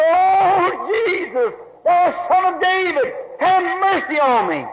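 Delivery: high pleading cry, drawn out slowly. Acted. Blind beggar crying out to Jesus on the road, high pleading cry. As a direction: call desperately to someone passing far off. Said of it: "He raised his hand".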